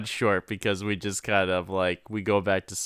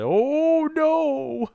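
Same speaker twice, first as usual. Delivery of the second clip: squealed much higher, mock terror; mock panic. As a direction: shriek it like a horror victim. Imitating alien panic.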